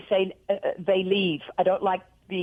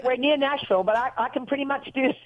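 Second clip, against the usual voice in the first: higher-pitched put-on Southern accent; demonstrating a Southern US accent; Southern drawl; 4 semitones higher than usual. Shown as a demonstration. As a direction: show off a playful Southern drawl. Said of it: "you should have a Southern draw".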